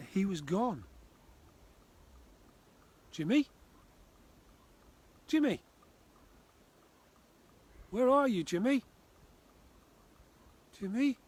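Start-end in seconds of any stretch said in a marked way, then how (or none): 3.1-8.8 much higher, louder anxious calling. Acted. Searching for missing child. 10.8-11.2 much higher, louder anxious calling. Acted. Calling for lost child.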